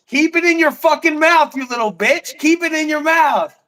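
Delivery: loud, high, jeering commands, pushing him on; taunting shout. Real, not acted. Streamer yelling dares at Shiva over stream, loud and jeering. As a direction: bark crude dares to hype him up.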